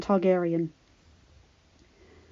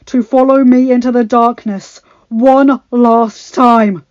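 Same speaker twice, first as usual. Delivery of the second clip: rousing battle speech; 3 semitones higher, 10 dB louder than usual. Acted. Jon on dragonback rallying the army, loud and stirring. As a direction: stir them to fight one last time.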